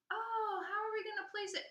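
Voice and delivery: mock clerk. Imitating the postal clerk.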